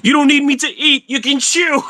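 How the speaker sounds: high comic retort; high put-on character voice. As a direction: pitch it up as the character. Character talks back in voice.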